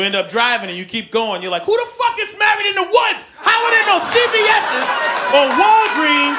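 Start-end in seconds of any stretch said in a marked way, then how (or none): 1.6-6.4 much higher, shouted outrage; husband raging with no store in sight; furious rant. Retold or imitated. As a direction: yell at the empty road. Husband ranting while driving.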